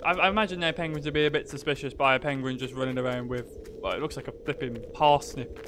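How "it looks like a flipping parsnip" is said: normal talking voice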